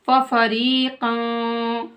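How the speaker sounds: फफरीक़न slow, ending in a held nasal hum; ikhfa. Shown as a demonstration. Teacher showing hidden tanween, humming end of फफरीक़न. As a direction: chant फफरीक़न with long ee and hidden n. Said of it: "इख्फा हो रहा है".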